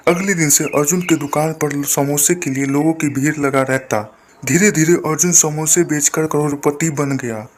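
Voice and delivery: plain reading voice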